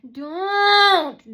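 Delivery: high, loud drawn-out "don't"; mock scream. Imitating hecklers before they throw things, mock scream. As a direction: wail the word in imitation.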